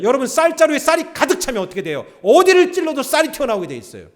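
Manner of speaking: loud, high pulpit preaching; full-force preaching. Acted. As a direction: drive the point home to the congregation. (높고 크게 힘찬 설교)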